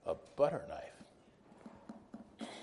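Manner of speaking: breathy unvoiced repeat, incredulous; stage-whispered disbelief; whispered aside. Real, not acted. Incredulous repeat of absurd detail.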